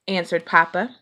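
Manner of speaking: ordinary delivery, nothing marked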